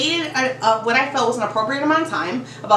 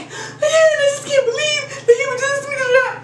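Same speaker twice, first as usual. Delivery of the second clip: high, whiny mock sob, much higher; mock crying; 14 semitones higher than usual. Coworker still crying about the breakup, wailing. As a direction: wail it like a hurt friend. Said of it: "So she's like".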